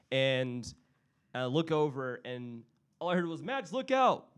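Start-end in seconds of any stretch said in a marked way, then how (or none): 3.4-4.3 high, urgent mock shout; alarmed cry, pitched up high; retold shouted warning. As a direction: call out a sudden warning. Shouted warning retold in accident story.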